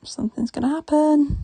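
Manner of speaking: brace loudly for a plot twist; tense anticipation. Real, not acted. Reader sensing a twist coming, loud anticipation. Excited live reaction while reading.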